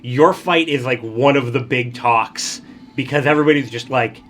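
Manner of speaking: ordinary talk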